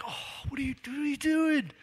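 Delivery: high, scandalised mock question; mock judgmental gasp. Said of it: "people looking at you going like".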